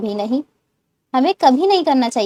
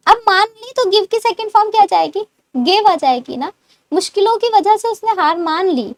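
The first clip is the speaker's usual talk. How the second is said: ordinary talk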